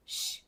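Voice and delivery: श as a soft hushed sh; sh sound. Shown as a demonstration. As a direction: hold a quiet hissing श. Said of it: "वो क्या sound देगा?". (धीमी श ध्वनि)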